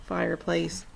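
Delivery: ordinary delivery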